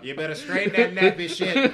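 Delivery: loud, high bossy scolding voice; mock stern hot comb lady. Friend joking as the hot comb inventor, loud bossy scolding. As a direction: boss them around like a salon matriarch. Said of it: "Dead serious".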